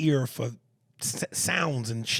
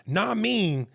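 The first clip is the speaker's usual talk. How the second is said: New York slang; 7 semitones higher, 4 dB louder than usual. Shown as a demonstration. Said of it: "you could spell nah mean".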